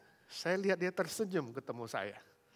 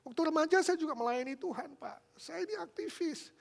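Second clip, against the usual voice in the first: high, whiny tearful protest; acted whimpering self-defence; imitated tearful man; 14 semitones higher than usual. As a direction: insist pleadingly in a raised, weepy voice. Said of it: "Dia bicara sambil nangis".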